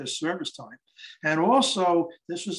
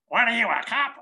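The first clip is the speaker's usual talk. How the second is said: high, louder challenge to a stranger; pitched-up suspicious question; suspicious older lady; 8 semitones higher, 5 dB louder than usual. Retold or imitated. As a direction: imitate a wary old lady demanding answers. Older lady challenges him, acted.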